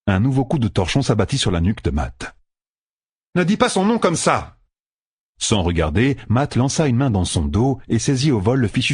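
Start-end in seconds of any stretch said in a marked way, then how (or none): 3.3-4.6 high, snapping rebuke; sharp rebuke. A narrator's reading. Jaime scolds Matt for saying Tuon's name, sharp and high.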